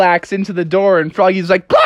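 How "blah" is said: high mock shriek; startled scream. Froggy sees the axe come through, mock shriek. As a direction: cry out as if dodging an axe.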